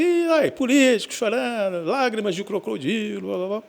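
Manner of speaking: high, dismissive mockery of a crying politician; mocking scoff. Cynics scoffing at a senator's tears, mocking. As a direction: sneer it off like a cynic. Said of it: "teve gente que falou assim".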